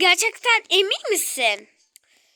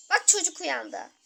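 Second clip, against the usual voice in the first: ordinary talk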